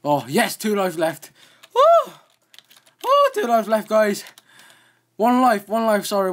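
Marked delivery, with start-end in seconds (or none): 1.7-2.1 very high, loud victory whoop; joyful whoop. Real, not acted. Gamer cheering at surviving the jump, loud whoop. 3.1-6.3 higher, louder relieved chatter; bubbling over with relief; excited relief. Real, not acted. Gamer tells viewers about lives left, excited relief.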